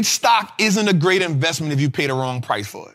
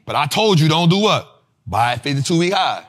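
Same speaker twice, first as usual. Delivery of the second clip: usual speaking voice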